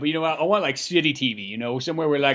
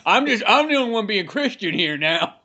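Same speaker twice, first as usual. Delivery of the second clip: mock trapper; 7 semitones higher, 3 dB louder than usual.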